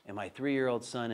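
ordinary talk, nothing marked

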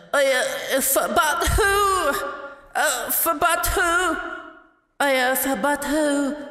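high, slow kawaii voice; squeaky anime-girl cuteness, drawn out; fake cute voice. Shown as a demonstration. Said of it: "That's my kawaii voice".

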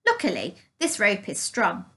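ordinary delivery

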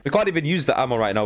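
normal talking voice

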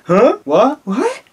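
high, rising, incredulous blurts; mock astonishment. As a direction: react like a chorus of shocked friends. Skit friends react to shocking news.

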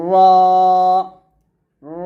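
র rolled and held long with a thick vowel; sustained, heavy র for learners to copy. Shown as a demonstration. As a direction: model each র slowly, lips natural. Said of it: "এইভাবে".

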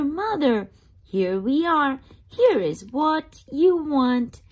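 usual speaking voice

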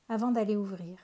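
usual speaking voice

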